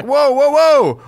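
high, loud "whoa"s; scene: a husband startled by talk of divorce, mock alarm. Back off in hammed-up panic. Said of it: "You're like".

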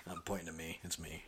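whispered aside, pointing at himself; playful whisper. Real, not acted. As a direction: drop to a teasing whisper to hint the answer is him. Playful aside while child thinks.

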